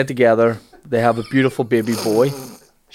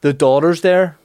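ordinary talk

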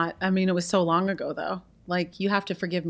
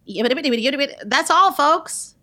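raised cartoon sign-off after a stuttering run-up; Bugs Bunny; 4 semitones higher, much slower than usual. Guest guesses at a cartoon impression, pitched up. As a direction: put on a high, lively cartoon voice. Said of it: "Bugs Bunny".

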